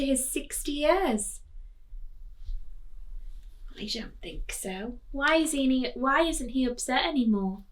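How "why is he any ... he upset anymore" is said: usual speaking voice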